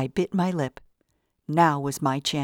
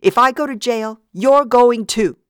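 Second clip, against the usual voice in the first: furious shout; 8 semitones higher, 8 dB louder than usual. A narrator's reading. Vida shouting a threat at the others, furious. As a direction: hurl the threat at them. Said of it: "she shouted".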